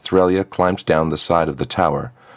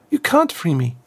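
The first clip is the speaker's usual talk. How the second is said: put-on much higher, hopeless girl's voice; resigned trapped girl; 11 semitones higher than usual. Trapped girl tells Azriakam she cannot be freed, high and resigned. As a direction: tell her gently it is no use.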